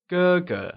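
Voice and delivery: "ge ge" with a high, level first syllable. Shown as a demonstration. Teacher models the word for elder brother, "ge ge" in high level tone. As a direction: hold the first half of "ge ge" high and even. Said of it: "pronounced using the high level tone".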